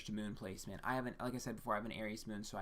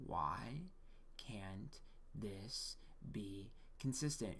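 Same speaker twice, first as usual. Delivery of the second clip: slow, breathy inner whisper; a slow exasperated whisper to yourself; frustrated whisper; 2 semitones lower, 7 dB quieter, much slower than usual. Retold or imitated. Taurus nature's frustrated inner voice.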